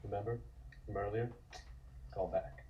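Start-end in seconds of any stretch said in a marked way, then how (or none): none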